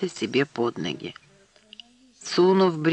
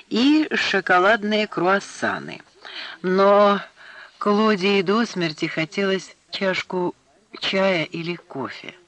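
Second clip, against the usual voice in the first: plain reading voice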